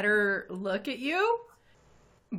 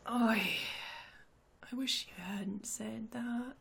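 near-whispered regret, much softer; ominous hush; 10 dB quieter than usual. Acted. Boss to his accusers before a fight, ominous near-whisper. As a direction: drop to a menacing whisper.

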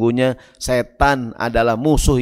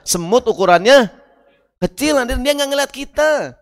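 high pleading excuse; 9 semitones higher, 4 dB louder than usual. Acted. Ant king excusing Sulaiman.

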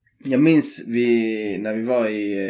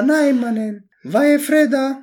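high, insistent mock classmate voice; pitched-up friend impression; imitating the classmate; 11 semitones higher, slower than usual. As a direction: push the word ljus up, near laughing. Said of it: "jag måste göra hans röst då".

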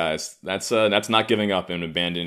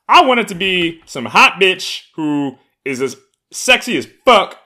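playful exaggeration; 11 semitones higher, 10 dB louder than usual. Real, not acted. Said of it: "joking about therapist".

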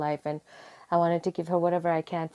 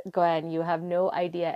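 ordinary delivery

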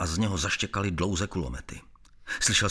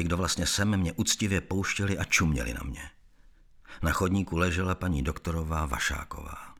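plain reading voice

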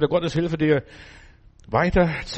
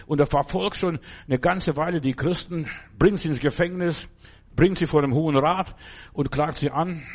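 ordinary talk, nothing marked

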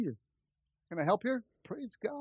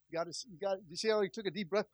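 normal talking voice

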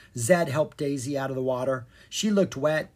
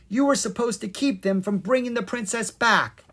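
high, raised-voice telling-off; scolding; 8 semitones higher, 4 dB louder than usual. A narrator's reading. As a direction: scold a son who let you down. Fairy scolds her son.

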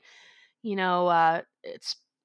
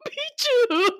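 crying; 14 semitones higher, 5 dB louder than usual. Retold or imitated. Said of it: "laughs, crying".

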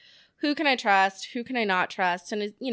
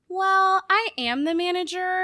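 high, pleased gotcha reply; smug comeback; 11 semitones higher, 5 dB louder, slower than usual. Retold or imitated. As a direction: savor the comeback. Retorting to a demanding customer.

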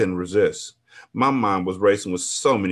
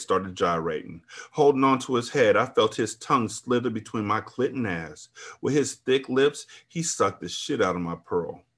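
usual speaking voice

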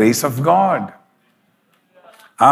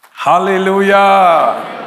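drawn-out shout; 5 semitones higher, 4 dB louder, slower than usual. Real, not acted. Preacher calls out to congregation.

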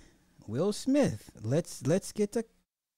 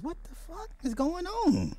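much higher, squeaky disbelief; much higher mock-shocked squeal; 12 semitones higher than usual. Real, not acted. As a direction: gasp it like you can't believe it. Host reacts to angry rant.

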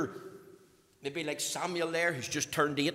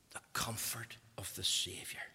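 hushed, breathy whisper of the phrase; 7 semitones lower, 10 dB quieter than usual. Real, not acted. Preacher whispering the heading to the congregation. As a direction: let it fall to a whisper.

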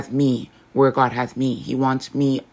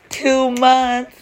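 much higher, louder with pride; a thrilled, high exclamation; excited; 11 semitones higher, 5 dB louder than usual. Real, not acted. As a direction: repeat it with a proud, high burst of joy. Excited repeat of two months.

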